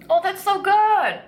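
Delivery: slow, high, loud delight. Real, not acted. Reacting to first bite.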